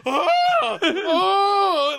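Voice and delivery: high, loud mock wailing; overwhelmed wail. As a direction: imitate a man who can't cope, wailing. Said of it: "he can't deal with what's happening".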